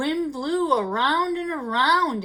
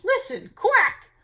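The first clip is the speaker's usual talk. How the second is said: cow quacking; 7 semitones higher, 3 dB louder than usual. A narrator's reading. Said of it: "Cow trotted up".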